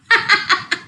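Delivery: high mocking laugh; judges laughing. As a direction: laugh at the idea of a pet fly. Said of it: "The judges laughed".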